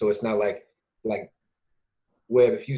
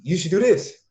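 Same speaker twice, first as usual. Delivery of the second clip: mock bossy advice; 8 semitones higher, 6 dB louder, slower than usual. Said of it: "the bossy advice said again".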